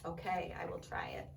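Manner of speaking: timid whispered resolve. Acted. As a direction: breathe it out nervously. Elephant nervously resolves to try.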